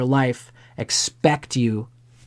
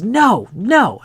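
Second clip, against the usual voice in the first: put-on high, loud child's refusal; stubborn kid refusing rice, high and loud; whiny kid; 9 semitones higher, 5 dB louder than usual. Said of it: "The kid goes".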